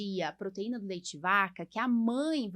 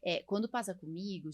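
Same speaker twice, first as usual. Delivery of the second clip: ordinary talk, nothing marked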